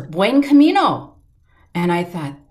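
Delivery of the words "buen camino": the same greeting now near and louder, higher; closer, louder. Retold or imitated. The stranger's greeting now close behind, louder. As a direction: call it out as if just behind her. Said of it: "I heard it closer".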